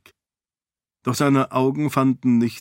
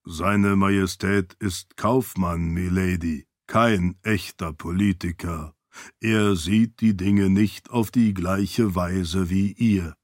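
low servant voice; 5 semitones lower than usual. Acted. Servant asks his lady, low calm voice. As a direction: ask it low and measured.

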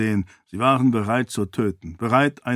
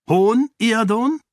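a much higher, louder, slow sneer; scornful challenge; 11 semitones higher, 5 dB louder, slower than usual. A narrator's reading. Sarene mocks the king's snort, scornful and slow. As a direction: throw his scorn back at him. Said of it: "fragte sie".

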